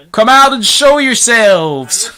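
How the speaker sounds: high, slow, loud command; drawn-out shouted order; police chief's shout. A narrator's reading. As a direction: call them out from a distance. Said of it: "shouted the chief of police".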